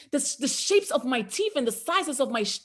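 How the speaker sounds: usual speaking voice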